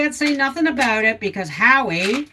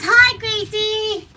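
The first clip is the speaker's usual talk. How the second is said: high, slow sing-song hello; cutesy chorus of hellos; cooing greeting; 12 semitones higher, 3 dB louder, slower than usual. Pretend. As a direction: coo at the dog like a fan. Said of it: "Everybody says".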